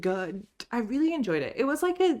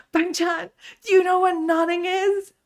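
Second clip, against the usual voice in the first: retold loud blurt; 11 semitones higher, 7 dB louder than usual. Friend's loud question retold.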